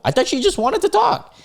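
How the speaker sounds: very high squeaky protest; incredulous falsetto outburst; exasperated disbelief. Real, not acted. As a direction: protest in a pitched-way-up voice. Exasperated reaction to her refusal.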